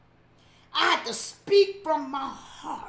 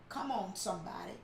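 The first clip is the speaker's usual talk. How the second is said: usual speaking voice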